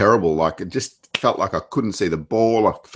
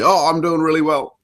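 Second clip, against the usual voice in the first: excited; 7 semitones higher than usual. Retold or imitated. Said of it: "I felt like".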